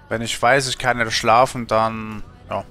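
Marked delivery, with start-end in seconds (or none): none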